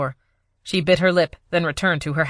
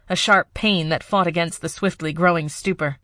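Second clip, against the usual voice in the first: plain reading voice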